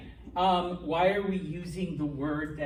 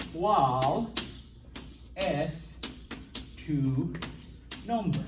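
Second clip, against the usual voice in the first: each word set apart by long pauses; much slower than usual. Shown as a demonstration. Teacher breaking the Spanish question into single words for the class. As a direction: pause after every word so they hear each one. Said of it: "is word for word".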